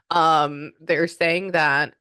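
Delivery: ordinary delivery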